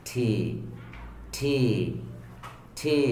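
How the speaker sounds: ঠি released softly from the middle; gentle spaced-out ঠি. Shown as a demonstration. Teacher drilling the letter T, soft middle sound. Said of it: "একদম soft".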